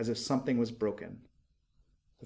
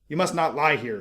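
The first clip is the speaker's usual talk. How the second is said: loud, higher stern shout; a night watchman shouting at huddled boys; 4 semitones higher, 7 dB louder than usual. A narrator's reading. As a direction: bark the order at the boys. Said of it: "shouted the watchman".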